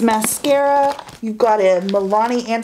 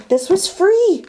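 high, bright emphasis on free; excited exclaim; 10 semitones higher than usual. Real, not acted. Telling viewers the haul was free, high excited emphasis. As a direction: stress free with rising delight. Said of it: "and this was all free".

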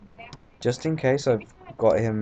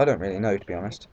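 ordinary talk

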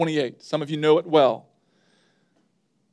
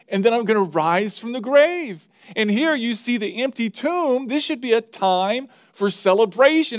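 higher, pressing sermon delivery; impassioned preaching; 5 semitones higher than usual. Acted. Pastor drives home the message to the congregation, fervent. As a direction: build the point with rising fervor.

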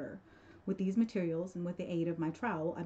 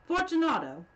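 much louder, higher, falling call; calling again; 11 semitones higher, 9 dB louder than usual. Acted. Montresor calls again into the niche, much louder and impatient. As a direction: shout the name into the silence. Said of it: "I called again".

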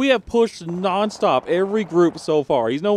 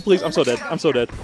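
normal talking voice

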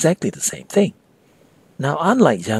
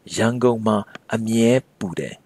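slow teaching read. Shown as a demonstration. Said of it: "you can also say".

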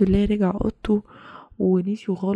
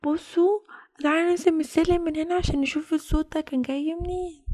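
usual speaking voice

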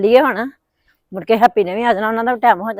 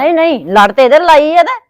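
heated protest; 6 semitones higher, 8 dB louder than usual. Acted. Aunt protests heatedly in family argument.

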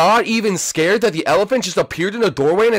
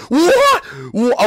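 high, loud yelp of disbelief; startled high-pitched outburst; baffled yelp; 11 semitones higher, 4 dB louder than usual. Real, not acted. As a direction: yelp it in total confusion. Baffled reaction to the video.

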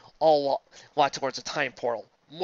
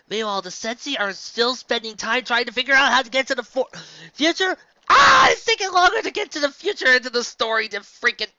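high, loud frustrated outburst; exasperated shout; 8 semitones higher, 9 dB louder than usual. Real, not acted. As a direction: yell in exasperation at the dragging story. Exasperated shout at slow fic.

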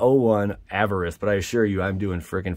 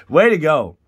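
ordinary talk, nothing marked

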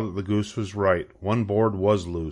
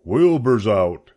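horses spreading news; 5 dB louder, slower than usual. A narrator's reading.

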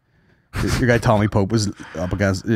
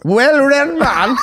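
high, slow, drawn-out query; mock incredulous echo; 14 semitones higher, 4 dB louder, much slower than usual. Co-host mocking his friend's phrase, high drawn-out disbelief. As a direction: throw it back in exaggerated disbelief. Mocks co-host's odd phrase back.

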